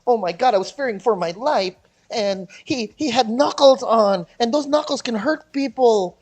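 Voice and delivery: loud, high mock of a frightened victim; mock excuse-maker. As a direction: plead loudly with hammed-up alarm. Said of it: "trying to pull shit out of his head like".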